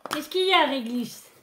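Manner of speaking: high, loud questioning call; calling to a pet. Real, not acted. Owner asks grumbling Réglisse what's up, calling out high. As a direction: call out brightly to the dog, pitch jumping up.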